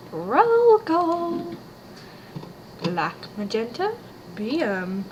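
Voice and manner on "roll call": calling out. Acted. Character calling out.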